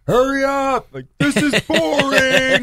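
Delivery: high-pitched mock heckling; imitated crowd yell. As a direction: heckle impatiently, high and stretched out. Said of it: "everyone just yells".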